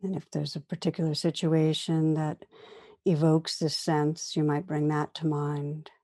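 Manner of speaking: calm meditation guide, long pauses between phrases; guided meditation. Real, not acted. Teacher inviting listeners to turn attention inward, guided meditation. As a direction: gently lead listeners inward, unhurried, leaving room after each phrase.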